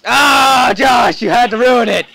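real high-pitched yell of frustration; angry shout. Real, not acted. Player blaming Josh for ruining it, angry shout. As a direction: shout it at the one who spoiled everything.

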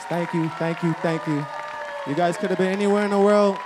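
shouted over applause; loud heartfelt thanks. Real, not acted. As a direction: call love to the crowd. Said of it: "emotional thank-yous shouted".

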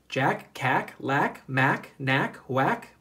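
ordinary delivery

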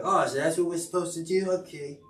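high, lightly put-on cat voice; voicing the cat.

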